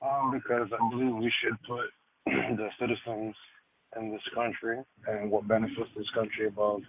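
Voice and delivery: faint, distant voice off mic; talking far from the microphone; from another room. Real, not acted. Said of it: "You sound like you're in another room".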